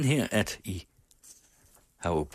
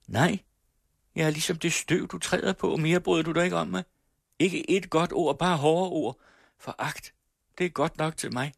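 much higher, quick wounded complaint; bitter hurt girl; 10 semitones higher than usual. Acted. Laura tells Mogens she is only dust to him, high and wounded. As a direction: throw her hurt back at him.

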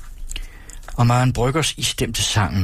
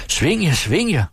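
high, urging cheer; mother cheering the farmhands on, high; cheering call; 9 semitones higher than usual. A narrator's reading. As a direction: egg the dancers on. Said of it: "råbte moren til kallen".

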